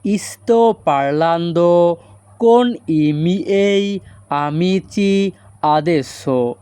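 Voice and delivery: very slow, drawn-out syllables; slow Italian model. Shown as a demonstration. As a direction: model the sentence slowly for students. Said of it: "এই বাক্যের Italian হবে". (ধীরে ধীরে Italian উচ্চারণ)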